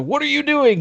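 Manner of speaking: catching your son in the act; startled parent. Retold or imitated. Dad walking in on his son, high surprised question. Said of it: "and I said".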